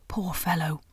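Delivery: a rough, throaty whisper, drawn back and wary; hoarse throaty whisper. A narrator's reading. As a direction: rasp it out under the breath like a nervous beaver. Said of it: "in a hoarse, throaty whisper".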